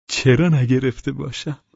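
high, drawn-out, mincing question; slightly affected. A narrator's reading. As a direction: put on airs, slowly, voice lifted. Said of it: "با اندکی ادا و شکلک گفت". (با کمی ادا و اطوار، کشیده و زیر)

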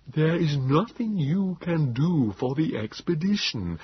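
high, slow, feeble creature voice; Nebogipfel. A narrator's reading. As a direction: explain weakly in long-drawn syllables. Wheezing Morlock explains danger.

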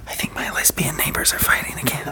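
hushed urgent whisper; whisper yelling. Real, not acted. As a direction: whisper so the neighbors can't hear. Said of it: "Why are we whispering?".

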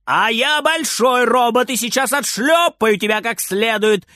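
grandma's high-pitched yell; scolding shout. A narrator's reading. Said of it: "кричит бабушка".

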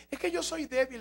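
mock whining excuse. Mocking a believer's excuse.

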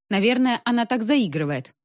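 usual speaking voice

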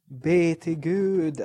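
slow, drawn-out pious cry; slow mock plea. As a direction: stretch it like a movie line. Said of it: "du skrek typ". (långsamt, teatraliskt rop)

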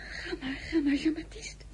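hushed, high frightened whisper; fearful whispered name; anxious whisper. Acted. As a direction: whisper his name in fear. Sending husband to the door.

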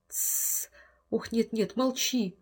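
hushed, unvoiced pleading. Wife begs Henry to hush, soft frightened plea. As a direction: beg him to keep quiet. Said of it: "умоляет жена".

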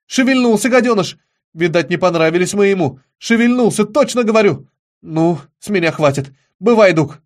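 high, loud, agitated outcry; excited insistence. A narrator's reading. Will insists to doubting Doug that it moved, loud and excited. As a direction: insist loudly, voice pitched right up.

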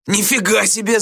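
high, indignant yelp; Gradusov wailing. A narrator's reading. Pupil sees his bag emptied, wailing. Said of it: "завопил Градусов".

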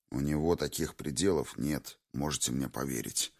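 low, subdued and grim; gloomy. A narrator's reading. Kazimir warns his squad about the enemy, gloomily. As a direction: drop your voice, sullenly certain. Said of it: "угрюмо сказал Казимир".

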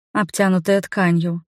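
ordinary delivery

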